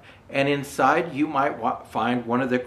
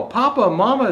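louder, higher calling out; excited children's shout; 8 semitones higher, 7 dB louder than usual. Acted. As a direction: call the parents from the window. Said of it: "they shout".

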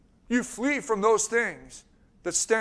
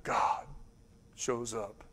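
ordinary talk

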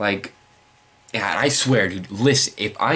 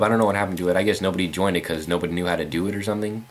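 ordinary delivery

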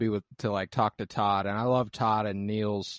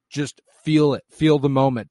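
louder, insistent coaxing from an acted-out teacher; urging teacher; 2 semitones higher, 8 dB louder than usual. Acting out Keating urging Todd.

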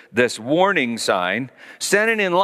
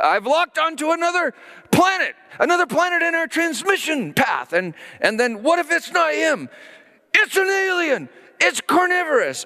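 high, urgent alarmed yelling, much higher; panicked technician yell; 13 semitones higher than usual. Retold or imitated. Technician warning riders of an alien, high panicked yell. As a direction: sound the alarm like a staged ride operator. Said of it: "one of the technicians yells".

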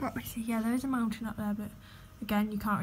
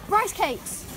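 excited; 8 semitones higher, 4 dB louder than usual. Real, not acted. Spotting item while shopping.

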